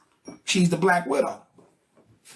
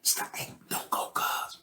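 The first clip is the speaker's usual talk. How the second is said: softer, whispered warning from friends; urgent whisper; 12 dB quieter than usual. Retold or imitated. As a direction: hiss a warning so she won't hear. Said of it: "homeboys is like".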